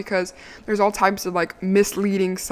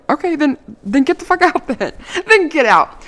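louder, fed-up mock yell; retold angry dismissal; 6 semitones higher, 5 dB louder than usual. Telling the boyfriend to leave, loud mock yell. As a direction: throw him out of the car, half laughing.

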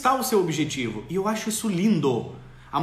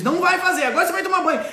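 much higher, louder mock scolding; bossy parent; 11 semitones higher, 6 dB louder, faster than usual. Parent ordering child to bath, mock scolding. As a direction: snap the order like a fed-up parent.

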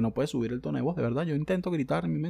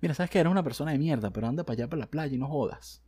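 ordinary talk, nothing marked

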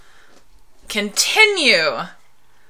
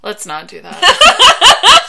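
high, slow laughing no; laughing protest; 10 semitones higher, 9 dB louder, much slower than usual. Real, not acted. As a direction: plead while laughing.

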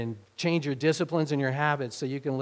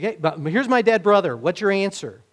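loud challenge; 6 semitones higher, 10 dB louder than usual. Acted. Grieving sister challenging Jesus.

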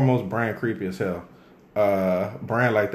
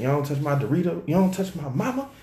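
mock kid scolding; 6 semitones higher than usual.

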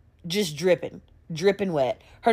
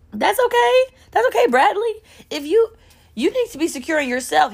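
very high coddling voice; mock reassuring; 11 semitones higher than usual. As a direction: pitch it way up, pretend to console Bradley. Mock consoling aside to Bradley.

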